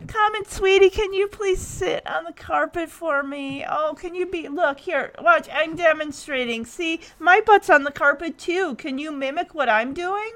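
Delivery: put-on sweet high baby talk to a puppy. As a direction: coo at the dog like a baby. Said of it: "he just starts talking in a baby voice".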